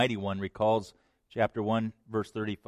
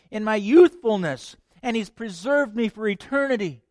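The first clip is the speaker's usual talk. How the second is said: Mary's exultant praise; 9 semitones higher, 5 dB louder than usual. Acted. Mary proclaiming to the congregation, loud and exultant. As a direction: preach Mary's joy over the crowd.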